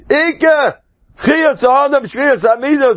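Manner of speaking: "Icke" cried out very high; emphatic cry. Real, not acted. Preacher proclaiming what the main thing is, emphatically.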